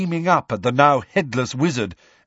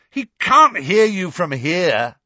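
raised, loud retort; yelling; 7 semitones higher, 5 dB louder than usual. A narrator's reading. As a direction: shout it across the hall. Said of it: "yelled Harry".

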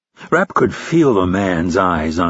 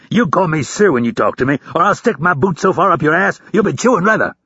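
high-pitched angry tirade; howled; 8 semitones higher than usual. A narrator's reading. Instructor demands recruit call him sir, howling. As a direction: chew him out like a drill instructor. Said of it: "Hurley howled with a veiny throat".

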